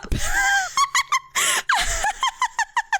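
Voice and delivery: high helpless laughter; shrieking laugh. Real, not acted. Streamer recalling the cannibal remark, shrieking laugh. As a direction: laugh until it cracks.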